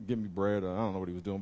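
ordinary talk, nothing marked

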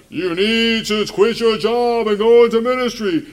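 loud, high-pitched booming command; mock voice of God. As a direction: proclaim it like a hammed-up God from above. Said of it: "that's what God's voice sounds like".

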